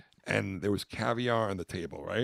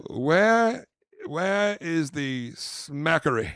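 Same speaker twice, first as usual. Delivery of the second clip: high, loud, drawn-out mock demand; pretend outraged guest; 6 semitones higher, 6 dB louder, much slower than usual. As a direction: demand it like a hammed-up posh-party diner. Plays outraged party guest.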